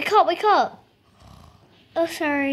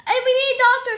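calling out; 10 semitones higher, 5 dB louder, much faster than usual. Pretend. Calling for help in play.

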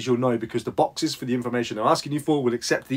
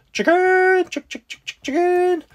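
high squeaky clucking chicken call; 4 dB louder, slower than usual. Pretend. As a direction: call it like summoning hens, chick chick chick. Calling a chicken name draw.

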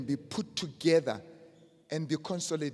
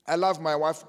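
ordinary talk, nothing marked

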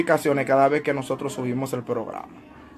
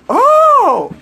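mock shock; 9 dB louder than usual. Learns the commenter is a deacon.

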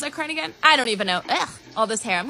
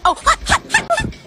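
rising squeaky yelps of hot. Real, not acted. Burnt by hot chocolate, squeaky yelps. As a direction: yelp at the burn.

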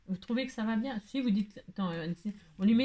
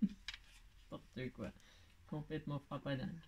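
breathy whisper, slow and much softer, mocking herself; whispered aside; 6 semitones lower, 14 dB quieter, slower than usual. Real, not acted. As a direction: whisper it to yourself, half laughing at your own silliness. (chuchoté, très doucement, en se moquant de soi)

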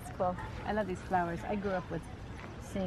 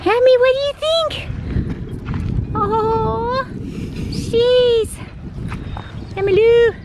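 dog talk; 13 semitones higher, much slower than usual. Real, not acted. Cooing at the shivering dog.

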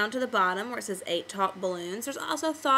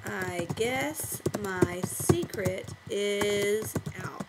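very slow, drawn-out words while typing; slow dictation to oneself; typing aloud; 3 semitones lower, much slower than usual. Shown as a demonstration. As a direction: stretch each word as you type it. Typing teacher's line aloud.

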